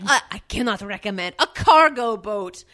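put-on French accent. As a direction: replay the French receptionist for laughs. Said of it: "with a very heavy French accent".

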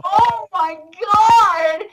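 high, long-drawn swoon of disbelief; overwhelmed. Retold or imitated. Fan learning her idol remembers her, overwhelmed. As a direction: stretch every word out in high-pitched awe.

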